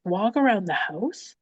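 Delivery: slow, incredulous questioning repeat; exasperated echo. Retold or imitated. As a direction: throw the suggestion back in disbelief. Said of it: "wanting to throat punch her".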